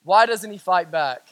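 subdued question. Acted. Repeats the sisters' question, subdued.

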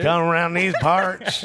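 a mock old-timer drawl; put-on accent. Joking about Harrison locals, slow put-on accent. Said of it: "I don't know what accent that is".

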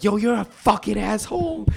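high-pitched imitation of an indignant aunt; outraged aunt. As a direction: mimic an aunt cussing out her relative. Quoting aunt's angry reply.

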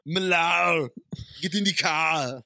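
Schwarzenegger doing Boston; thick Austrian Boston mix; Arnold Boston accent. Retold or imitated. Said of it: "Arnold doing a Boston accent".

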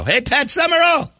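high excited fan holler; retold shout, voice leaping up; screamed out. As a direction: hail a celebrity across a crowd. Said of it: "And I screamed out".

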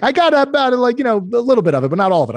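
high, sing-song Italian voice for the client; playful high Italian impression; imitating Italian accent. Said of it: "he was Italian".

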